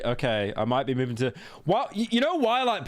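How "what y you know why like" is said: surprised. Real, not acted. Shocked at Delaware tax.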